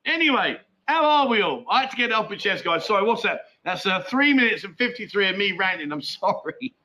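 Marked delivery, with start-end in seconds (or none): none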